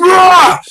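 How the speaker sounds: loud, high-pitched scream of rage; retold furious yell; enraged roar. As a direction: let out a pent-up "rah". Rages once the trooper leaves.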